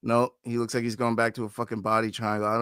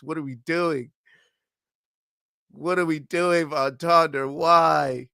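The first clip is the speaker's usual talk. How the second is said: higher, whining disbelief at the fighter; exasperated pleading; 6 semitones higher than usual. Real, not acted. Fan pleading with Von Tonder, exasperated whine. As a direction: beg him to stop doing this.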